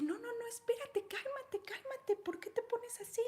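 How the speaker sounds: high-voiced flustered doctor; startled doctor. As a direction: beg the screaming assistant to calm down. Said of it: "la doctora le dice".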